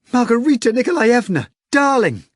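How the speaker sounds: high loud call; crying out. Acted. Natasha crying out to Margarita, high and loud. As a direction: call out with delight. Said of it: "Natasha cried".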